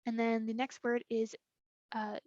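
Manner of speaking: ordinary talk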